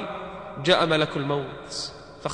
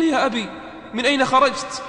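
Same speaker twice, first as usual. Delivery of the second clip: startled call; 8 semitones higher than usual. Acted. Dying man calls to father.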